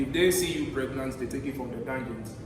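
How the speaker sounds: usual speaking voice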